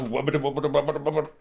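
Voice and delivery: low wobbling noise; imitated camera wobble. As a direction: babble it like a juddering camera. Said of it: "the camera's all like".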